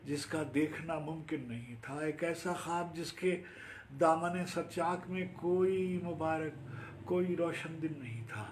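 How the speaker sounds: high, sustained declamation; rising Urdu poetic recital; poetry recitation. Acted. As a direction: hold the verse aloft to the final line. Recites own Urdu poem.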